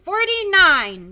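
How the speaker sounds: put-on high, slow kid answer; small boy answering; eager boy voice.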